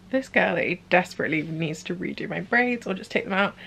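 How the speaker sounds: usual speaking voice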